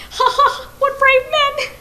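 high, loud mocking laughter; gleeful shouting laugh. A narrator's reading. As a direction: laugh and shout with cruel glee. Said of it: "he shouted".

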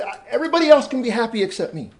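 loud, high wail imitating an upset boy; upset, frustrated. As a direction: act out a boy's frustrated protest. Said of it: "this boy was crying".

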